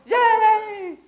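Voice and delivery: high, loud cheer of delighted nurses. Retold or imitated. Nurses cheering at the birth, a high, loud cheer. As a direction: squeal it like the nurses celebrating. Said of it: "you can hear 'em".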